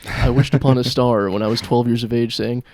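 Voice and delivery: ordinary delivery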